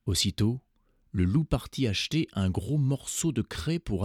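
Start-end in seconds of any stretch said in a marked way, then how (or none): none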